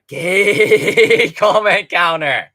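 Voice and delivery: real laughter; laughing. Real, not acted. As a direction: laugh bursts through the words. Laughing at own joke.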